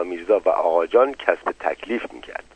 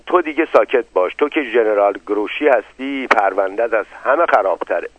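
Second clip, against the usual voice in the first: usual speaking voice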